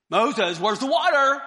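loud call through the tent wall. Acted. Crowd outside the tent calling Moses at night, loud nagging call.

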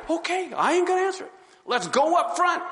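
high, fast, eager to please; nervous compliance. Retold or imitated. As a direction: rush it out, scared.

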